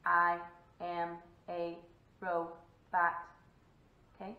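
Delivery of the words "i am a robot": slow monotone robot, each syllable apart; stiff, low robot with gaps between words. Shown as a demonstration. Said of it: "robots kinda drag things out".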